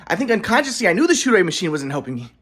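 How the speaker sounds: ordinary talk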